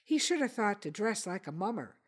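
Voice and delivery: plain reading voice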